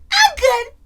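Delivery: put-on high little girl saying she is fine. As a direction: answer like a small girl. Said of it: "She's like".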